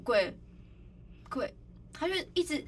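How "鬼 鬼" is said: haltingly, one word at a time; guilty reluctance, acted out; hesitant, holding back. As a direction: start to speak, then stop. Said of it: "欲言又止".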